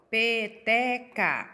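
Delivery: "Peteca" bem devagar, pe-te-ca; separação silábica. Shown as a demonstration. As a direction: escanda "Peteca" em três sílabas separadas. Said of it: "quando ela tem três sílabas". ("Peteca" pausado, sílaba por sílaba)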